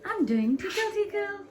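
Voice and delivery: high singsong tickle talk; giggly tickle attack. Real, not acted.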